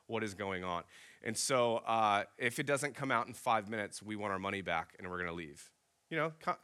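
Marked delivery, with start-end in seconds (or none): 0.1-0.8 softer, patient and reasonable; calm reasoning. Retold or imitated. Wife reasoning patiently with the server, softly. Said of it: "Alyssa's trying to reason with her". 2.4-5.7 softer, patient and reasonable; calm reasoning. Retold or imitated. Wife reasoning patiently with the server, softly. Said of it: "Alyssa's trying to reason with her".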